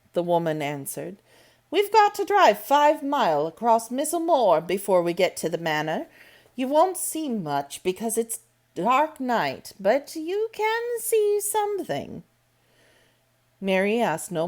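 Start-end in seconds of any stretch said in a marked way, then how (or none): 1.7-12.2 higher altered voice of a stern housekeeper; Medlock's voice. A narrator's reading. Medlock telling Mary about the moor, brisk. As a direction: explain it matter-of-factly.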